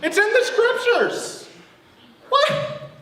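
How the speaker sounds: very high, comic squeal of disbelief; incredulous outburst. Acted. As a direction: squeak it out in mock amazement. Mock disbelief at the quoted prayer.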